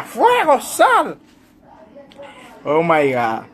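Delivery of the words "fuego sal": a touch higher, mock command; lightly voiced preacher ordering fire out. Preacher commanding the fire to leave, lightly mocked. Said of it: "Sí, diría".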